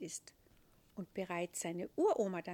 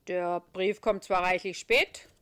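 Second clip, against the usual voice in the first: put-on louder, changed voice of the old saint; a weighty heavenly boss musing; Petrus; 13 dB louder than usual. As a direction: Petrus musing to the little angel.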